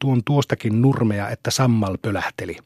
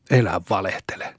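lower, dismissive don't-lie jab; scornful retort; 4 semitones lower than usual. A narrator's reading. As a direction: cut him down flatly. Boy accusing friend of lying. (halveksiva tokaisu)

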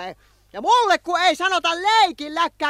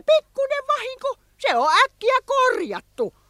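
much higher, airy brush-off; dismissive; 11 semitones higher than usual. Acted. Villain shrugs off the damage to everyone, airily. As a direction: wave it away as nothing.

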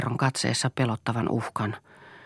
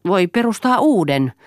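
higher, louder irritated retort; annoyed; 7 semitones higher, 7 dB louder than usual. A narrator's reading. Eero retorts to Lea that a family can be replaced, annoyed. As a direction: snap back with irritation. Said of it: "sanoi Eero ärsyyntyneenä".

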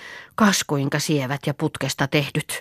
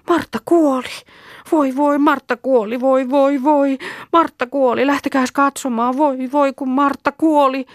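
high, insistent lament; distraught wailing; 9 semitones higher than usual. Acted. Kerttu telling the girls that Martta died, distraught wailing. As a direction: cry the news out high, in alarm.

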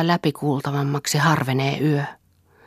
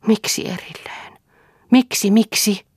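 pleading; 8 semitones higher than usual. Acted. Mirtia pleads at parting.

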